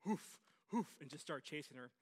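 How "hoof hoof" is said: short, loud, high mock woofs; imitated dog bark. Preacher voicing a big dog in his story, barking. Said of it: "saw this dog go".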